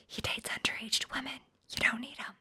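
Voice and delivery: hushed inner voice; whisper. Pretend. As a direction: tell yourself a secret rumor. Inner rumor to oneself.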